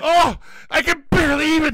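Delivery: high, fast over-the-top yell; mock ecstatic shout. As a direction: scream it like a wrestler overcome.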